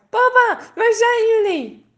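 high, loud anxious call. A narrator's reading. Lost girl calling her parents in the forest, loud and high. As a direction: shout into the woods for them.

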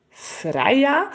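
Freya asked with a rising, puzzled lift; surprised question. Acted. Mother, puzzled by the new name, asks Freya with a surprised rise.

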